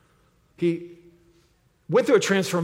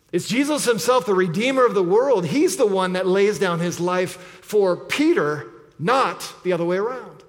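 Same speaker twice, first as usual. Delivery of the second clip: normal talking voice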